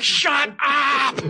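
high shrill yell, half laughing; high shouted snap. Retold or imitated. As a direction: snap at them to shut up. Retold snapping at people.

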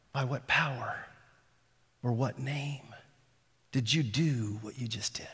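soft, hushed awe; awed whisper. Retold or imitated. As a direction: ask it in breathless wonder. Voicing people's awed question.

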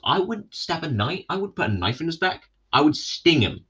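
a hint of a gleeful murderer; mock wasp killer. Pretending to be the wasp.

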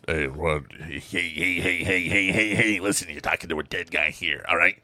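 lightly voiced character with insistent rapid-fire heys; insistent impression of a wise guy. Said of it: "he actually has an upper register voice".

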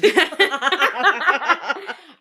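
loud, high burst of real laughter; hearty laugh. Real, not acted. Joking with co-host about having two friends, hearty laugh. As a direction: crack up in a long, high cackle.

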